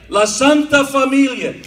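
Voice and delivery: grand Italian accent. Shown as a demonstration. Comic selling the school name as a social club, grandly. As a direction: announce La Santa Familia like a New York social club. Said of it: "If you say it like that".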